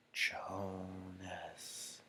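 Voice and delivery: a barely audible murmur of the name; hushed whisper. A narrator's reading. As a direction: breathe the name from far off in the crowd. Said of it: "It was a whisper at first, hushed, barely audible".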